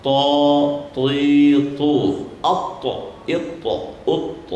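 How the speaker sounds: To, ti, tu with a heavy emphatic t; careful emphatic t syllables; to drills. Shown as a demonstration. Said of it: "Satu lagi, to".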